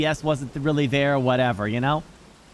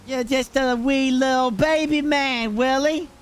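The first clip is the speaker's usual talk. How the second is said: put-on condescending baby talk; 5 semitones higher than usual. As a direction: talk down to him like a toddler. Mock praising friend as baby.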